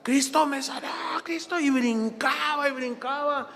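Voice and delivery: high, excited mock cry; imitating jubilant man. Healed neighbour jumping and testifying, imitated jubilation.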